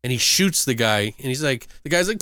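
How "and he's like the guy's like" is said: normal talking voice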